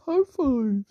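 high-pitched gleeful cheer; happy. Acted. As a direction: squeal it with delight. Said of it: "he gets so happy and he says".

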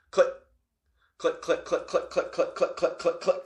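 rapid mouth clicks mimicking locks. Telling the door story, imitating a long run of lock clicks. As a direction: click it out like a row of deadbolts.

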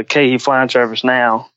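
ordinary talk, nothing marked

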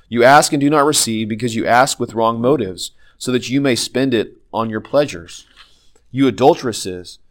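ordinary delivery

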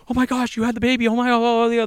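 high, flustered gasps of surprise; shocked nurses. Retold or imitated. Nurses mocked as panicking over the home birth, high and flustered. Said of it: "They're like".

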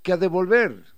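"que a devolver" with the pitch jumping up and hitting "devolver" hard and loud; highland Quichua-style intonation. Shown as a demonstration. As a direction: sing "que a devolver" up high, landing heavy on "devolver". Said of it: "y la entonación es".

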